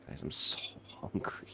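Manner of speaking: breathy whispered complaint, I'm so hungry. Acted. Streamer whispers to chat that he's starving. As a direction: whine it under the breath.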